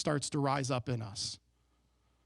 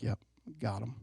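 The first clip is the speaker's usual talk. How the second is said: quiet smug aside; 7 semitones lower, 6 dB quieter than usual. Retold or imitated. Smug aside, thinking he won.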